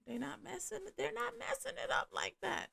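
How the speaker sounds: very high, soft voice; playful squeak. Real, not acted. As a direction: say it softly way up in a tiny high register. Playful emphatic reassurance about partners.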